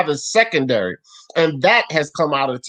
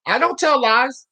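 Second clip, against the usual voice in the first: raised voice, cutting in; loud interruption; 9 semitones higher, 4 dB louder than usual. Real, not acted. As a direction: shout him down to interrupt. Cutting off in heated argument.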